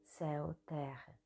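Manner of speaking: soft, unhurried meditation voice with long pauses; hushed, even meditation guidance; guided meditation. Acted. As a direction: guide a listener gently through body movements, leaving space between phrases.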